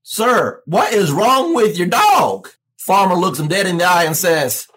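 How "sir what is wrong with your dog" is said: mildly raised, slowed-down worried salesman; alarmed salesman. Acted. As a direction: demand an answer about the dog.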